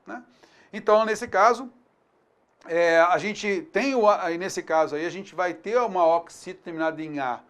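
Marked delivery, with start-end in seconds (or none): none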